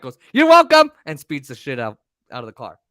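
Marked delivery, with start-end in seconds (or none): 0.3-0.9 loud, high-pitched sarcastic shout; sassing back. Retold or imitated. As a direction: imitate a mom hurling sass at a worker. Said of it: "my mom to sass him back".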